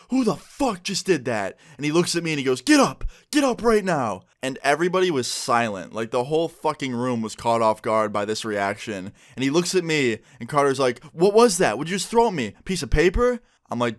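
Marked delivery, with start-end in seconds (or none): 0.1-1.6 angry demand. Retold or imitated. Angry classmate hit by paper. 2.6-4.2 high, loud furious yell; retelling a classmate's furious challenge with a real shout; angry shout. Retold or imitated. Carter furiously demands a fight. 11.1-13.4 angry confrontation. Retold or imitated. Carter angrily confronts the narrator.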